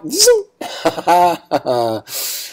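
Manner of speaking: buzzing zoom noise, then laughter; laughing. Real, not acted. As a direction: break up laughing at your own joke. Jokes about riding an e-bike.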